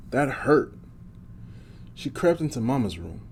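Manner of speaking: plain reading voice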